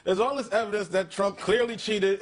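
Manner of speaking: ordinary delivery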